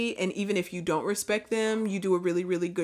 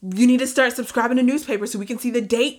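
louder, higher demanding voice; demanding viewers; 5 semitones higher, 6 dB louder, faster than usual. Retold or imitated. Viewers to Tiffany, louder higher demanding nagging. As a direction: nag her like suspicious fans. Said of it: "They're gonna be like".

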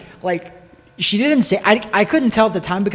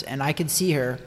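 normal talking voice

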